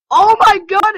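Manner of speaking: high, loud oh my god; shocked shout. Real, not acted. Spotting something awful on screen, a high shocked shout. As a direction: blurt it out in shock.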